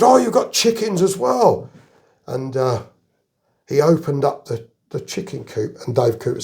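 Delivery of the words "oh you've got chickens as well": surprised delight. Retold or imitated.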